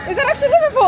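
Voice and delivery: high, loud disbelieving question; surprised. Real, not acted. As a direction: squeal it in disbelief. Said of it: "That's not English".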